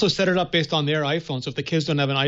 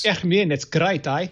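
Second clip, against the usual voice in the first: put-on a light South African accent, relaxed and friendly; a hint of South African, easygoing; 2 semitones higher, slower than usual. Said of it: "I love it when you do that accent".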